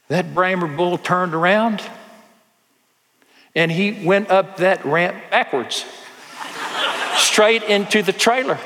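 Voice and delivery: retelling the rancher's miracle, building up in pitch; mounting amazement. Retold or imitated. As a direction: build the wonder to the last word. Climax of the bull story.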